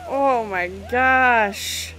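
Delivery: long, drawn-out, loud "oh my gosh"; shocked exclamation. Real, not acted. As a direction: stretch every word in disbelief. Shocked at burning scene.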